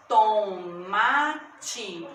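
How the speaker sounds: TO, MA, TE, three drawn-out beats; split into syllables. Shown as a demonstration. Teacher showing three mouth openings in tomate, syllable by syllable. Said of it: "Eu abro minha boca três vezes pra pronunciar".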